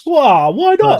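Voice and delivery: high, loud "why not"; surprised protest. Real, not acted. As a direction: protest in shock. Host refuses kookaburra impression.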